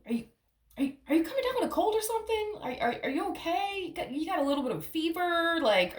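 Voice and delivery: high, fast, fussing worried voice; worried partner. Retold or imitated. Partner anxiously checks whether Virgo is sick, high fretting voice. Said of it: "this person might randomly ask you, like".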